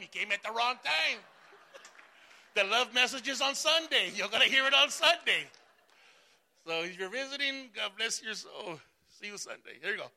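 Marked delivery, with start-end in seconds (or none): 0.0-5.5 loud, half-joking scold to the church; mock scolding. Pastor jokingly scolds his church, loud mock scold. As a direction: play it up like a parent mid-spanking. Preacher jokes visitors arrived at spanking.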